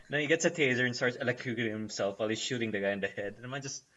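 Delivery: usual speaking voice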